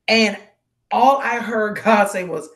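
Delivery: normal talking voice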